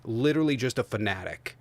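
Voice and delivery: ordinary talk, nothing marked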